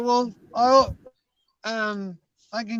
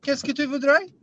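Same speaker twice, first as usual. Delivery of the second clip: French; 4 semitones higher, 5 dB louder than usual. Shown as a demonstration. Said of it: "I have a really good French accent".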